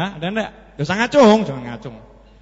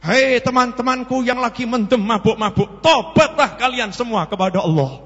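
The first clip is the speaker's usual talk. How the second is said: higher, declaimed call to repentance; sudden preacher; 7 semitones higher, 3 dB louder than usual. Acted. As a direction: hail the drunken friends like an impromptu preacher. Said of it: "Mendadak ceramah". (seruan tobat ala penceramah)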